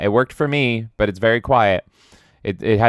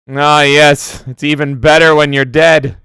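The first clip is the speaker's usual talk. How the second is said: loud, high mock critic; booming imitation of the critic; 5 semitones higher, 10 dB louder than usual. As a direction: ham up the critic's punchline. Streamer reads critic quote in character.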